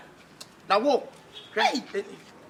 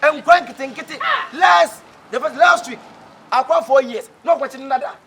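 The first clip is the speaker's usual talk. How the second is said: angry shouting; 8 dB louder than usual. Acted. Complaining to the master about years, angry shout. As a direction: yell it at him in protest.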